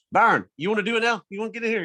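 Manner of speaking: high-pitched mock offer to someone across the way; lightly acted holler; imitated call-over. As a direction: pitch it up as if calling a buddy over. Imitates John calling over to Byron.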